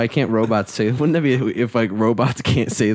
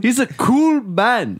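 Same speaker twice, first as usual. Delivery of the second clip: slow, higher mock South African voice; South African accent; 12 semitones higher, slower than usual. Copying Ninja's line for the co-hosts, slow high South African accent. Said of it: "Is that your South African?".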